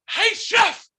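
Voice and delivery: shrill cartoonish call of hey chef; high-pitched yelp of a timid dishwasher; squeaky dishwasher voice. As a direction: mimic him in a very high, loud voice. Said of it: "who was like".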